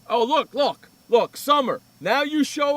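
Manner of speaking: loud, high teasing call to a latecomer; mock loud call-out. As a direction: rib him loudly for showing up late. Mock greeting to late cohost.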